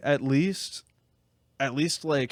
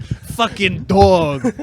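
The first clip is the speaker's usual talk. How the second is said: drawn-out mock curse; 4 semitones higher, 9 dB louder, much slower than usual. Echoing a line, drawn out.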